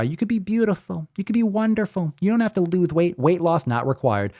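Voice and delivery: high, gushing mock-supportive voice; mocking high sugary voice. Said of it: "having these morons try to justify".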